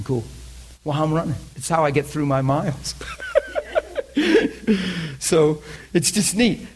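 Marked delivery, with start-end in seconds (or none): 3.0-5.1 high, real chuckling fit; gleeful laughter. Real, not acted. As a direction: laugh to yourself with delight. Laughs after telling story.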